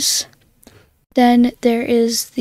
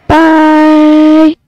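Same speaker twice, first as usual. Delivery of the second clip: loud altered bye sample; voice-changed playback; processed playback; 3 semitones higher, 14 dB louder than usual. Shown as a demonstration. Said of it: "I've done this one".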